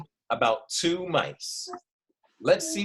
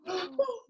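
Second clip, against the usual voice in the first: scared gasp; 6 dB quieter than usual. Shown as a demonstration. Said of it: "That's how you show you're scared".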